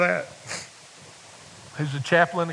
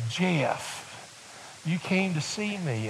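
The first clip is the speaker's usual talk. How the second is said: put-on frail old lady voice; 4 semitones higher, 5 dB quieter, much slower than usual. Elderly woman voiced in story.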